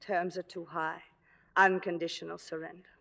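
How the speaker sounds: low, soft, weary-sounding reply; subdued refusal. Acted. Actress rejecting her boyfriend's peace terms, subdued refusal. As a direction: turn it down quietly.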